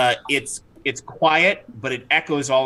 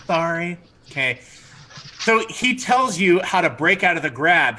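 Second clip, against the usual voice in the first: normal talking voice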